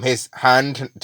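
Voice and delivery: ordinary delivery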